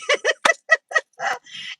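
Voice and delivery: high burst of laughter; real high-pitched laughing. Real, not acted. Host cracks up after the birthday song, laughing.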